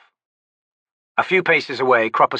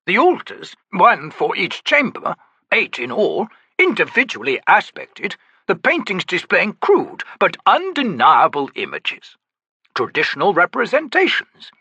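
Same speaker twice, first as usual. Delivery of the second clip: lofty, theatrical, higher; grand old Daru; 8 semitones higher, 4 dB louder than usual. Acted. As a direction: play the eccentric old trickster. Cropper's long speech.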